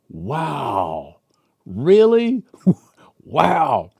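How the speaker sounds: jaw dropping in disbelief; amazed wow. Retold or imitated. Listening to the president's talk, stunned wow. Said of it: "several times I went".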